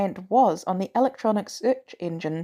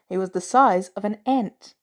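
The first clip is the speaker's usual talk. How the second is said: ordinary delivery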